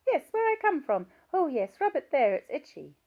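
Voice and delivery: put-on high, loud little-girl voice from an old woman; childish voice. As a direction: play an old patient talking like a small child. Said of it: "The childish voice continued".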